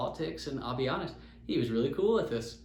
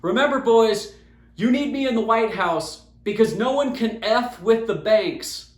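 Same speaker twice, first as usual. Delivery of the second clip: higher, much louder parting shout; loud retold yell; 6 semitones higher, 8 dB louder than usual. Trump yells back at the golfers while driving away, loud. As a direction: yell it back over your shoulder. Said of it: "he yells at us real loud".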